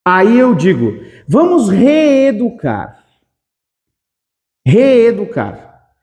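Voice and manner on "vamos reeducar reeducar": REEDUCAR slowly, with both E's held long; stretched double E. Shown as a demonstration. Teacher showing the two E's together, stretched vowels. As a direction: draw out the "ee" in "reeducar". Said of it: "Olha como esse E e esse E estão juntos".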